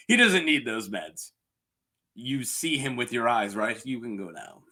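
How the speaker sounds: low, quiet dismissive voice; Larys quote. Retold or imitated. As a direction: drop low and soft to send him away. Acts out Larys denying the meds.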